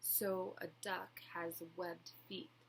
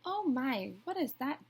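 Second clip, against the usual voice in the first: higher, wondering duck voice; lightly voiced duck; 5 semitones higher, 7 dB louder than usual. A narrator's reading. Reading a picture book to children, lightly voiced duck. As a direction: quack out a surprised question, lightly. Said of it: "Duck quacked".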